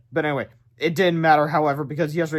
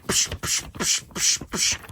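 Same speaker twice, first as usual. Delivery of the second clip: breathy psh psh hits; punch sound effects; much slower than usual. Acted. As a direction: spit out little punch noises.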